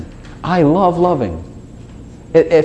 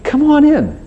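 welcoming call; 14 semitones higher than usual. Acted. God to the sinner outside, welcoming call. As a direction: call them inside, pitched way up.

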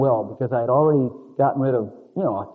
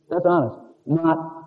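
ordinary talk

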